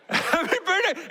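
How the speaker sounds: a laughing father mimicking his son's squeal; imitating whining toddler. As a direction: wail it like a two-year-old claiming the cookie. Toddler wants whole cookie.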